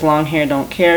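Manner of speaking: ordinary talk, nothing marked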